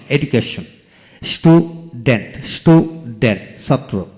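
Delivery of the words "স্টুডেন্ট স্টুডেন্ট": Student in two slow beats, stu, dent; long pause after stew in student; split into syllables. Shown as a demonstration. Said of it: "যতটুকু অংশ একসাথে উচ্চারণ".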